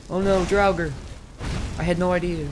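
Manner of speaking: startled by the monster; surprised. Real, not acted. Sudden enemy appears.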